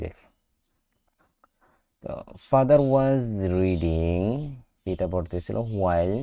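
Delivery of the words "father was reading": slow model reading. Shown as a demonstration. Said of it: "teacher models sentence slowly".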